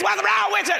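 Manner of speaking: sharp high yell; yelling at a kid. Retold or imitated. Preacher yelling at an unruly child. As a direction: snap at the child loudly.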